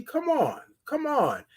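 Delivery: high, soft teasing come on; playful hype. Real, not acted. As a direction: egg them on up high. Excited over the tea.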